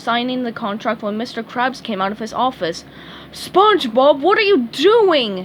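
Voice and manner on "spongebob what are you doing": loud, higher angry yell. A narrator's reading. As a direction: yell at the fry cook. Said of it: "he yelled".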